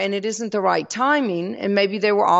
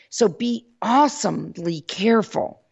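ordinary delivery

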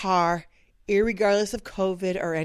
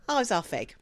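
cynical aside; 7 dB quieter than usual. Retold or imitated. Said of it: "my cynical brain always goes".

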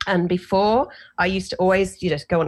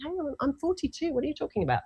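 high, softer disbelieving protest; incredulous; 9 semitones higher, 7 dB quieter, faster than usual. Retold or imitated. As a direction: act stunned by the news. Disbelief at father's diagnosis.

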